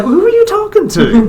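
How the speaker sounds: high-pitched mock questioning wife; imitated wife. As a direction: call it out in a high falsetto as the wife. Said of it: "My wife says at the door".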